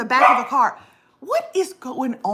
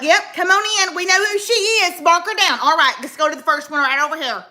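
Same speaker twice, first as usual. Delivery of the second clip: lightly voiced brisk poll worker, higher; 6 semitones higher, 4 dB louder than usual. Host imitating poll workers ushering her in, brisk. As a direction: rush her in like an eager clerk. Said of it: "They were like".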